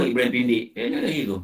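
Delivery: normal talking voice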